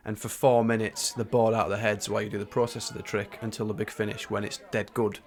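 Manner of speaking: ordinary talk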